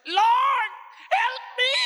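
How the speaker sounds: high loud desperate cry; crying out for help. Acted. Woman begging Jesus for help, high desperate shout. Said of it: "sinabi niya".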